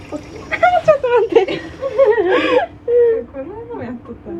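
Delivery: laughing while squirming from tickling; gasping laughter, ticklish; being tickled. Real, not acted. (くすぐられて笑いながら)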